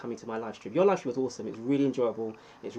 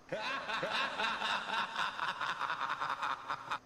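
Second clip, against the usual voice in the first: hammed-up evil villain laugh; 7 semitones higher, 6 dB quieter than usual. Streamer mock-plotting world domination, evil cackle. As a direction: cackle like a cartoon supervillain.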